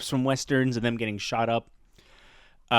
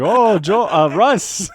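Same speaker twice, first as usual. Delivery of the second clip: high, loud blurt, then self-correct; mock covering up a slip, near laughter; loud flustered correction; 7 semitones higher, 9 dB louder, slower than usual. Teacher nearly using friend's real name, loud flustered correction.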